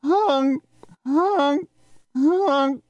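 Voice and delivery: high, far-off imitated goose honking; distant goose honks. A narrator's reading. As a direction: honk like faraway geese overhead. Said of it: "the distant".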